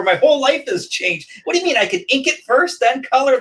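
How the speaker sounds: high, excited mock disbelief; mock amazement. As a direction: gush like it changed your life. Mock amazed rant about inking.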